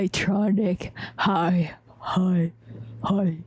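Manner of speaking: very low repeated greeting; short, deep hellos. Shown as a demonstration. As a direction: drop each hi to the bottom of your range. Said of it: "this is my deep vo".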